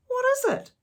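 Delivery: high, loud startled question; surprised question. Acted. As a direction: caught off guard.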